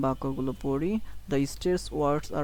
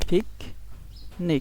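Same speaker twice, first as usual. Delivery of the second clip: Picnic split slowly, weight on পিক; slow two-beat picnic, first beat stronger; stress on first syllable; 3 semitones higher, much slower than usual. Shown as a demonstration. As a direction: stress পিক, pause, drop on নিক in picnic. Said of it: "এটা একটু জোরে বলতে হবে".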